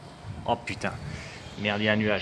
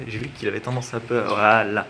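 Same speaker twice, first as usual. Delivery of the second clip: ordinary talk, nothing marked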